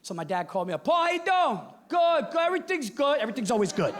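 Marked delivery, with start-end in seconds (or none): 0.8-3.2 put-on high, hearty old Italian dad; dad on the phone. Bronx father calling his son, hearty high greeting.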